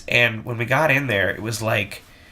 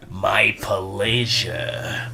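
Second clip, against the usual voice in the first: "My pleasure" slowed right down, low; slow drawn-out pleasure; 4 semitones lower, much slower than usual. Retold or imitated. Manager showing the girls the line, slow and low. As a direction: stretch "My pleasure" into three long syllables. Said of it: "strengthen it out to three syllables".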